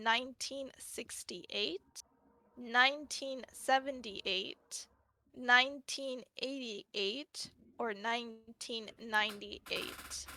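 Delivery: slow, deliberate quiz-show options; slow quiz reading. Acted. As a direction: draw out each option slowly.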